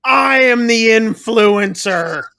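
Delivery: hammed-up heroic shout at the ghost; mock defiant yell. [name] yelling back at Mildred, hammed-up defiant shout. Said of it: "he yells".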